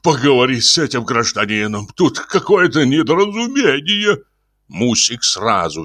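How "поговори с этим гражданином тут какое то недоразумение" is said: winded, higher-pitched appeal; flustered, puffed request; breathing heavily. Acted. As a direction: hand the problem to the wife, worn out. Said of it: "сказал он, тяжело дыша".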